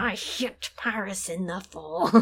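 slow, breathy whispered mutter; under her breath. Retold or imitated. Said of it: "under her breath".

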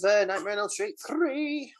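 ordinary delivery

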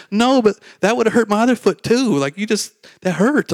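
high indignant protest. Retold or imitated. Friend protesting to the speaker, high and indignant. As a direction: protest that the kick hurt anyway.

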